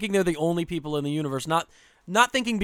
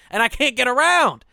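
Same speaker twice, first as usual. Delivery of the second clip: high, loud exasperation; frustrated outburst; 8 semitones higher, 6 dB louder than usual. Retold or imitated. Skier stuck behind a filming mother, frustrated. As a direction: burst out, pitched up and louder.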